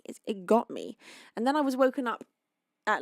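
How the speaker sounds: ordinary talk, nothing marked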